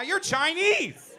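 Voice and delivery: slow, high mock yell; high drawn-out comic shout. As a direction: bellow it slowly at him like an accusation. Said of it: "Just yelling at a Chinese guy".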